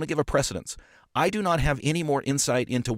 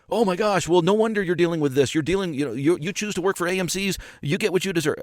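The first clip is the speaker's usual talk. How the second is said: higher-pitched mimicked reproach; mock scolding; 8 semitones higher than usual. Group members scolding a colleague who asked a question, mock scolding. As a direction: imitate an exasperated know-it-all telling someone off.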